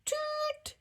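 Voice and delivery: very high piping toot; train toot. Acted. Kids playing train drivers, high toot. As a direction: toot like a toy train whistle. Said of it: "yelled the drivers".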